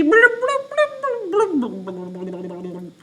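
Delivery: high, loud bubbly blums dropping down; high loud blubbing, fading. Shown as a demonstration. Teacher reads underwater, high blums falling off. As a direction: start with a high gurgle then sink. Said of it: "pretend to be underwater".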